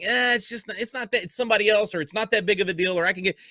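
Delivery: shrugging it off, pitched high; mock dismissive shrug. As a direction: brush it off like it's nothing, in a mocking voice. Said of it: "and we're like".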